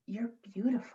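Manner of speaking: hushed compliment; whispering. Acted. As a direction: breathe it out softly.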